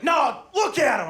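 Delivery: high, loud, drawn-out urging; stretched-out shout of insistence; insistent proud dad. Acted. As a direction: imitate a buddy pushing his baby on you. Buddy insists on showing his baby.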